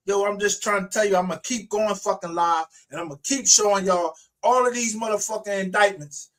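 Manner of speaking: heated, insistent threatening tirade; angry threat. Real, not acted. Angry threats to online rivals.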